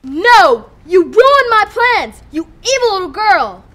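loud, slow furious yell; angry villain shout. Acted. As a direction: bellow it at her.

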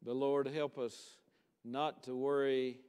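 hushed-down, level invitation to pray; even monotone with long reverent pauses; solemn prayer. Real, not acted. Preacher leading the congregation in closing prayer, solemn prayer.

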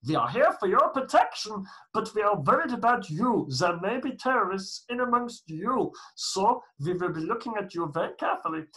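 loud, high mock German guard; German accent. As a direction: menace them in a put-on German accent. Acted SA guards, same German accent.

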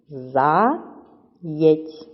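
"Заєць" as two separate syllables, За, pause, єць; teacher slowly dividing "Заєць" after За; split into syllables. Shown as a demonstration. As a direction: break "Заєць" apart: За... єць. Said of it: "Перевіряємо, чи так у вас".

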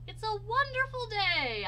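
high, loud delight; storybook groundhog overjoyed; delighted exclamation. A narrator's reading. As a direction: exclaim it joyfully.

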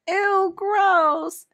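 high loud squeal of disgust. Acted. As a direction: squeal like it's the grossest thing. Girl disgusted at kiss.

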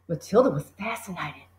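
ordinary delivery, nothing marked

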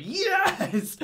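very high, loud squeal of joy; excited yell. Retold or imitated. Reactor acting out a first watch, excited yell. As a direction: burst out like a thrilled fan. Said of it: "I'm just so excited".

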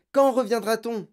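put-on high, loud child's plea; anxious girl calling out; urgent question. A narrator's reading. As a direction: demand to know, dismayed. Girl presses father in alarm.

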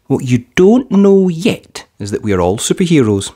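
plain reading voice

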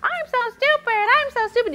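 squeaky, very high cartoon mouse voice; loud, silly high-pitched mouse; Mickey Mouse. Shown as a demonstration. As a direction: chirp it in a high cartoon falsetto. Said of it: "say in a Mickey Mouse voice".

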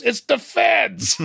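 high, drawn-out mock alarm cry; slow high-pitched mock holler; imitated goon's yell. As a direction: yell it like a panicked henchman, hammed up. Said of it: "one of the goons yells".

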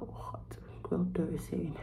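whispered under the breath; voiceless whisper of despair. Retold or imitated. Despairing thought at the airport.

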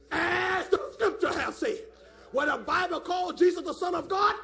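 loud, high bursts of fervent preaching; forceful pulpit shout; full-force preaching. Real, not acted. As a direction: preach it hard, driving the key words up.

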